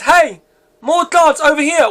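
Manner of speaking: high, slow call for backup. A narrator's reading. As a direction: hail colleagues from a distance.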